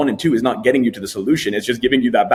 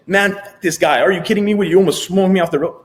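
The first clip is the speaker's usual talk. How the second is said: higher, louder fed-up outburst at the other driver; angry driver; 7 semitones higher, 3 dB louder than usual. Retold or imitated. Driver in the seat, cursing the guy who cut him off, mock fury. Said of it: "That is you saying".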